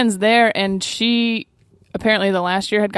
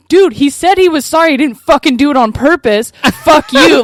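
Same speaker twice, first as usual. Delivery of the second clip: louder, higher furious yell; retold angry yell; 6 semitones higher, 6 dB louder than usual. Narrator yelling at the bartender to defend friend, angry. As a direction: tell the bartender off hard. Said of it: "And I'm, like, yelling".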